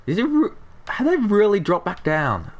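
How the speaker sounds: sudden high startled question; surprised. Real, not acted. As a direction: caught off guard by the falling prices. Prices dropped, shocked question.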